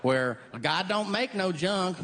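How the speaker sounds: high, slow Southern drawl. Retold or imitated. Comic imitating the South, slow Southern drawl. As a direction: lean into a folksy Southern accent.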